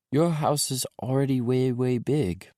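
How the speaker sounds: put-on high, eager little boy. A narrator's reading. Small boy telling dad his toys are at mommy's, little boy voice.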